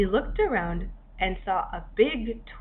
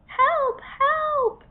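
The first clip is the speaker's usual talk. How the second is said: high, louder desperate calls; tiny cries for help; 5 dB louder than usual. A narrator's reading. Tiny trapped hermit crab calling out again for help. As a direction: cry out high and urgent, twice.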